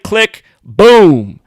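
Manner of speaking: mouth-made click and boom; mock gunshot. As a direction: make the gun sound with your mouth. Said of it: "listen to this click".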